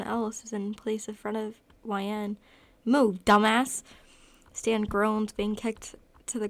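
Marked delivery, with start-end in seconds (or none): 2.8-3.8 loud, forceful order to get aside; brusque command. A narrator's reading. Kenny shoves Stan away from his sister, barked order. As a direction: push someone out of the way with your voice.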